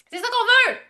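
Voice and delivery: high-pitched, excited cheer; excited squeal. Real, not acted. Repeats the cheer, higher.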